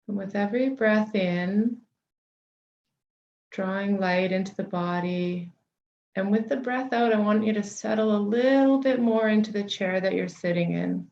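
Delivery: unhurried meditation guide, long pauses between phrases; guided meditation. Real, not acted. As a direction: settle listeners gently into the body, leaving space for each breath.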